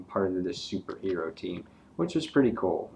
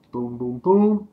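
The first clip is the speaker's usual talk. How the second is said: louder, punchy boom boom boom; punch sound effects; 4 semitones higher, 7 dB louder than usual. Acted. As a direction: hit each boom like a comic blow. Narrating keyboard blows.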